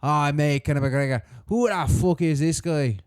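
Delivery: put-on botched Irish accent sliding into Australian; accidental Australian accent. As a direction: do a McGregor that comes out Australian. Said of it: "you were sounding Australian for a second".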